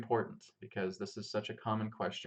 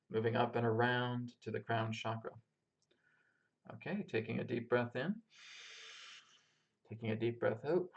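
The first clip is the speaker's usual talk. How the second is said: calm, unhurried meditation guide; grounding meditation. Acted. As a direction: lead them gently through the breath and body. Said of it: "we're gonna start off with a grounding meditation".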